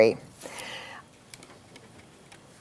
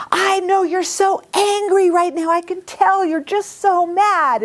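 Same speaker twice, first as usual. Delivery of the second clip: matching intensity; 11 semitones higher than usual. Retold or imitated. Mother matching son's intensity.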